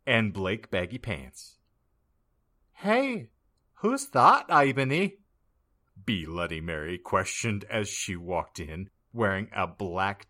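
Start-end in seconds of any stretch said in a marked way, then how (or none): 2.7-5.2 high curious voice. Acted. Bloody Mary asks.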